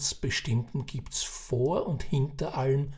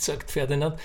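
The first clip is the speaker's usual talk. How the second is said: ordinary delivery, nothing marked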